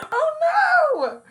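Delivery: high, loud startled cry of alarm. Real, not acted. Owner yelping at her cat, startled loud cry. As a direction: yelp as the cat lunges. Cat attacks, she cries out.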